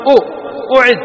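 "أو، أعد" as a short "u", then "u-ʕid"; همزة مضمومة. Shown as a demonstration. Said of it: "لأن همزة الوصل مضمومة". («أو، أعد» بضمة قصيرة)

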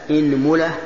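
"إنملة" said as inmula; opening i, middle u. Shown as a demonstration. Teacher lists variants for students, "إنملة" as inmula. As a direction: say "إنملة" with kasra on hamza, damma on mim. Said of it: "كسر الهمزة".

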